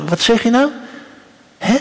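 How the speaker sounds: high, rising query; questioning mother. Acted. Moeder asks her kleine meisje to repeat, high and questioning. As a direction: ask it high, as to a small child. Acts mother asking whispering child.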